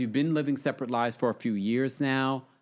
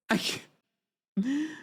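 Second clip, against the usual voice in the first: laughing; 10 semitones higher, much slower than usual. Retold or imitated. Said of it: "I'm like".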